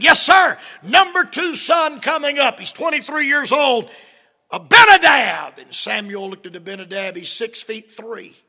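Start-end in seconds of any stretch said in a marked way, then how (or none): 0.0-5.6 loud, high, showing off a son; hammed-up eager presenting; eager proud father. Jesse eagerly presents his son.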